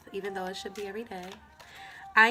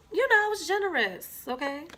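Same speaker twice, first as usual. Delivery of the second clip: higher and louder, pushing it; emphatic insistence; 10 semitones higher, 6 dB louder than usual. Real, not acted. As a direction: insist with a grin.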